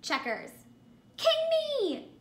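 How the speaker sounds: usual speaking voice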